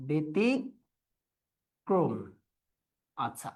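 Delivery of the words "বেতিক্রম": ব্যতিক্রম split as "be-ti... krom" with a long pause; closed, very slow. Shown as a demonstration. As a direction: model ব্যতিক্রম slowly in pieces, narrow e. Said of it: "ব্যতিক্রম হবে".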